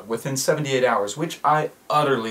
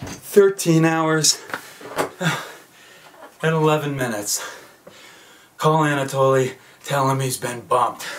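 Matt from Gravity; 3 semitones higher, 7 dB louder than usual. Acted. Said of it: "This is Matt from Gravity".

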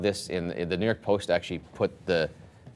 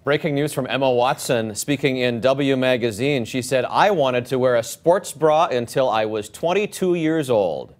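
grave breaking-news read, a touch higher; serious news anchor voice; 3 semitones higher, 4 dB louder than usual. Pretend. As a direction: announce it like urgent headline news. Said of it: "I'm reading them in serious news anchor voice".

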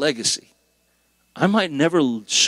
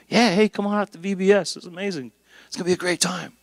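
higher-pitched upbeat pitch to passers-by; friendly invitation; 7 semitones higher than usual. Acted. Churchgoer inviting festival-goers to Bible school, friendly invitation. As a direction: invite them along, light and upbeat.

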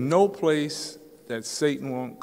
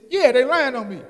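higher, louder complaining Satan; whining Satan; 12 semitones higher, 8 dB louder than usual. Acted. Satan insists to the passerby, loud and whiny. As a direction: whine it out like a wronged devil.

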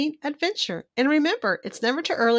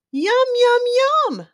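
much higher, gleeful yums; excited yum; 7 dB louder, much slower than usual. Acted. Clark delighted at the brownies on the counter. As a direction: sing out the yums like a kid spotting dessert.

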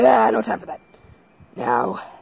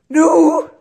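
much louder and higher, cry out in defiance; defiant shout; 10 semitones higher, 13 dB louder than usual. Acted. Hero defying the new god, a defiant shout. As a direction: scream it in protest.

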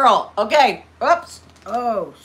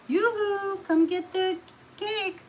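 sing-song call; 10 semitones higher, 6 dB quieter than usual. Real, not acted. Calling viewers to cake.

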